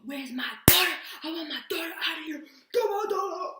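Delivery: high, loud imitation of a yelling dad; irate dad shouting. Dad yelling at police for his daughter, irate shouting. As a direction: bellow for your daughter like a furious father. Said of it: "I hear him. He's like".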